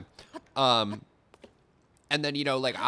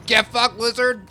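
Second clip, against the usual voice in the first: higher, louder repeat of "Get fucked, lizard"; louder taunt; 10 semitones higher, 8 dB louder than usual. Real, not acted. While fighting a lizard in the game, higher, louder taunt. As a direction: go up and hit it harder the second time.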